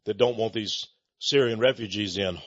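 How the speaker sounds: lightly voiced Obama, brushing off the critics; mock Obama. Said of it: "Obama laughed".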